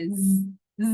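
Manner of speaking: ز held with a buzzing hum. Shown as a demonstration. Teacher demonstrating the buzzing ز for students. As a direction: buzz the ز loudly. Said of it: "اس میں buzzing sound ہے".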